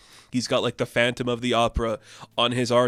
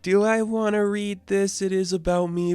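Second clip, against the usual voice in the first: mock Paige voice; 9 semitones higher, 3 dB louder than usual. Paige voiced reading the diary.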